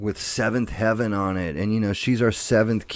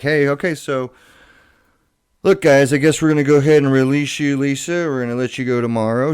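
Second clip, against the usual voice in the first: hospital staff; 5 semitones higher, 6 dB louder, faster than usual. Retold or imitated. Hospital staff voiced in story.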